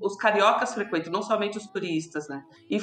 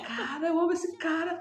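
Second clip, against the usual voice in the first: high, choked-up voice on the words of love; almost crying as she says she loves him; teary declaration; 8 semitones higher, 4 dB quieter than usual. Retold or imitated. Retells falling in love. (voz embargada, quase chorando)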